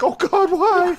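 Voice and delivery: very high, loud wailing cry of despair; hammed-up anguished wail; mock anguish. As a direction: wail to the heavens in hammed-up grief. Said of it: "and I'm like".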